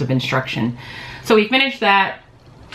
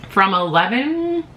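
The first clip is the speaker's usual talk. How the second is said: ordinary talk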